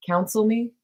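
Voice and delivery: ordinary talk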